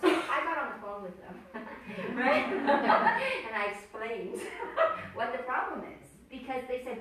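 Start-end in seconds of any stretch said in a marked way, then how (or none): 2.5-3.4 sudden high loud laugh; high burst of laughter. Real, not acted.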